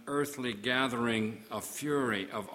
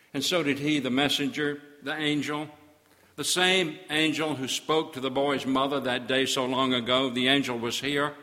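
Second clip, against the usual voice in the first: louder, declaiming preacher; loud preaching; rising sermon; 6 dB louder than usual. Acted. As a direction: proclaim the witness. Raised preaching passage.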